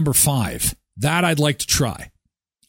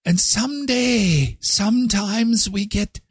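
higher, put-on solemn storyteller voice; hammed-up epic narrator; mock grand storyteller; 7 semitones higher than usual. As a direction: spin the tall tale like a fairytale. Mock grandiose Canada tale.

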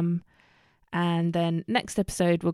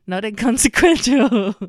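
much louder, laughing at her slip; loud self-correction; 5 semitones higher, 10 dB louder than usual. Real, not acted. Vlogger correcting her own wrong word, loud and amused. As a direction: blurt out the correction.

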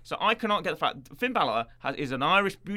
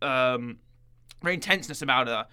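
normal talking voice

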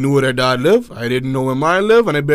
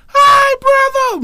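much higher, slow, drawn-out sing-song; mocking little sister; 6 dB louder, much slower than usual. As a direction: mimic the sister's grating greeting, stretched out. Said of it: "I couldn't stomach it".

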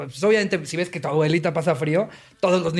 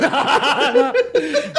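laughing; 7 semitones higher than usual. Real, not acted. Laugh burst.

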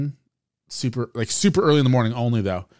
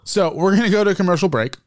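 upbeat radio host announcement; announcer voice; 6 semitones higher, 3 dB louder, faster than usual. Acted. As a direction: sell the break like a DJ. Podcast host announcing break.

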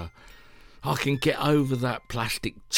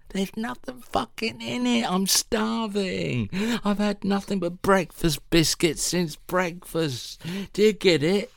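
high-pitched, starving exasperation; fed-up hungry rant, pitched right up; exasperated outburst; 8 semitones higher than usual. Acted. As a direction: protest in a high, strained complaint. Hungry, snaps at the Zoom listener.

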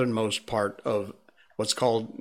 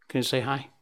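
ordinary talk

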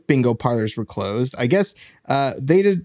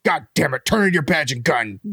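gruff barking police captain; 5 semitones higher than usual. Pretend. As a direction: bark at a rogue cop. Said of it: "Cash, you're a loose cannon".

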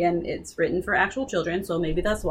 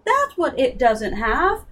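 excited realization; 13 semitones higher, 3 dB louder than usual. Retold or imitated. Meg's breakthrough realization.

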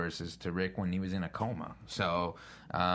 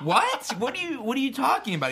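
much higher and louder, laughing disbelief; mock disbelief; 7 dB louder than usual. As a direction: squeal it in laughing shock. Retold shock at cheap price.

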